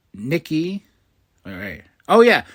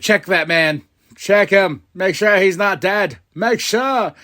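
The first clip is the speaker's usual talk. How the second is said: loud, raised-pitch mock alarm; alarmed urging; 4 semitones higher, 5 dB louder than usual. Fan urging a check on the downed wrestler, mock alarm. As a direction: urge them loudly to check on him.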